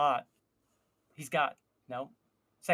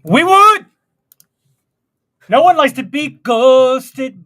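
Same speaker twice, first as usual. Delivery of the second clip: much higher, louder indignant cry; loud outburst; 5 semitones higher, 13 dB louder than usual. Acted. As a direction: shout it in mock outrage. Mock outrage about ghosting.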